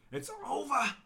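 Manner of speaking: It's over, wailed high and loud; mock dramatic wail. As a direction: cry It's over in hammed-up despair. Dramatic repeat.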